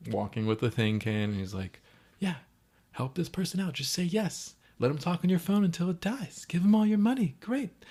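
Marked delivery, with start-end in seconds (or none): 2.2-7.8 high-pitched mock rant, climbing as it goes; acted exasperation, rising to a high sarcastic finish; sarcastic self-talk. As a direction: parody someone bitterly repeating advice. Retold sarcastic grumbling to himself.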